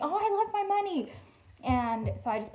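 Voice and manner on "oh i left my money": imagined panicked shopper. Retold or imitated. Imagined owner running back.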